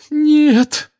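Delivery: very high, loud anguished moan; a despairing moaning no. Acted. Mrs Weasley seeing her husband dead, anguished moan. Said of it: "простонала миссис Уизли".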